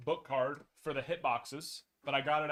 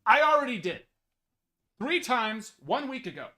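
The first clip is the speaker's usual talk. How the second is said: louder, higher, fed-up retort; exasperated outburst; 8 semitones higher, 9 dB louder than usual. Retold or imitated. Collector retelling his fed-up reply in a loud, higher voice. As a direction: snap back at the customer service message. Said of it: "And I was like".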